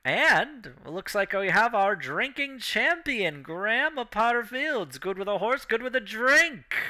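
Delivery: crown the winner like a ringmaster; announcer voice. Acted. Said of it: "the guy's just like".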